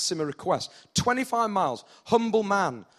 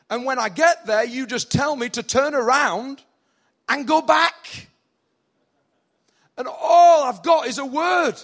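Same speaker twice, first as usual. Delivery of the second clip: raised, louder complaint building in pitch; exasperated protest; 4 semitones higher, 5 dB louder than usual. Acted. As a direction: protest being sent away, building to a cry. Official complains about being sent back.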